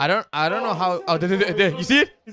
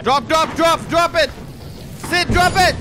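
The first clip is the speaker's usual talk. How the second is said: loud urgent commands; urgent shouting; 8 semitones higher, 4 dB louder than usual. Real, not acted. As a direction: bark the order to drop it. Urging teammate to drop item.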